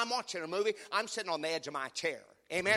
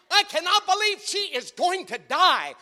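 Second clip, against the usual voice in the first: much higher, louder hammed-up panic; mock panic; 13 semitones higher, 11 dB louder than usual. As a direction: shriek at the screen in mock fright. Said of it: "this is me. I'm like this".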